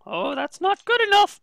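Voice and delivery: squeaky little fey voice; high brownie voice. Acted. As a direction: pipe it up like a tiny creature.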